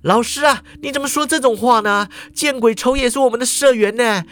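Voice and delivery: a loyal student scolding his teacher; indignant student. A narrator's reading. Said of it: "他就摇头说".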